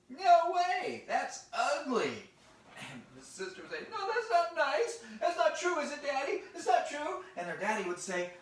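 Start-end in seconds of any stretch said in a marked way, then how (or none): 0.1-2.3 loud, high mocking dismissal; hammed-up big-brother put-down; scornful brother. Brother scorns sister's picture. 3.9-7.2 put-on high, loud childish protest; hammed-up hurt little girl; upset little sister.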